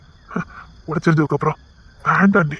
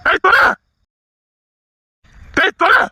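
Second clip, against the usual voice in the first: angry yell; 12 semitones higher than usual. Acted. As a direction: yell get lost in fury. Man yelling someone away.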